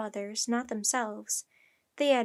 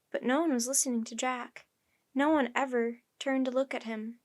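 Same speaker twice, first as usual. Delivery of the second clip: plain reading voice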